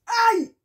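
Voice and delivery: sharp high ouch; pain yelp. Real, not acted. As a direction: yelp as it stings. Earring hurts going in.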